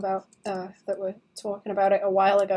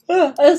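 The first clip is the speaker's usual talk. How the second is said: loud weary sigh from the heat; heavy sigh; 6 semitones higher, 11 dB louder than usual. Real, not acted. Streamer sighs at chat, too warm. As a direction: sigh it out, worn down. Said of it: "it's really warm in here".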